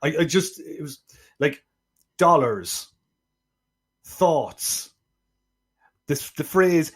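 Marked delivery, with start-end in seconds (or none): 2.2-4.9 imitating a botched Deep South accent; mocking a bad Deep South accent. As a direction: drawl the words like a failed Southern accent. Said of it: "things he couldn't say in a Deep South accent".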